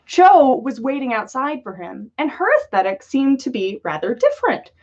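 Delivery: ordinary delivery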